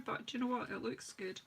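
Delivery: ordinary delivery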